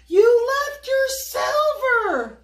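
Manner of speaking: high, loud, slow call after him; urgent breathless shout; panting call. Acted. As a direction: shout it out of breath while chasing. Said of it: "Little Chow panted".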